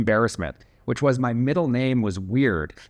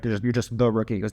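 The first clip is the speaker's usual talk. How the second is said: ordinary delivery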